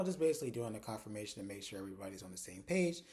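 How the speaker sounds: ordinary talk, nothing marked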